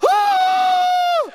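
high shrieking scream; panicked scream. Retold or imitated. Pastor seeing the numbers add up, a panicked scream. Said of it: "I'm panicking".

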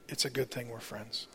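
very soft, low and tender; retold tearful tenderness. Crying wife to husband after school drop-off, hushed tenderness. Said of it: "she was crying and she said".

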